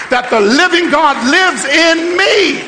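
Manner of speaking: slow shouted preaching climax; preaching at full force. Acted. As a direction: preach it with rising power.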